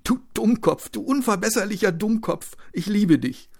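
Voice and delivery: high, loud, loving scolding; tearful affection. Acted. Wife to her stubborn wounded husband, tearfully fond scolding. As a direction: scold lovingly, between laughter and tears. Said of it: "lachte und hatte gleichzeitig Tränen".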